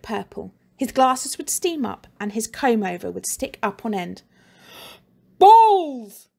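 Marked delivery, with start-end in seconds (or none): 5.4-6.3 much higher, very loud enraged bellow; furious shout. Acted. Mister Pent shouts as he foams at the mouth, furious. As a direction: explode with rage, foaming. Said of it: "Mister Pent would shout".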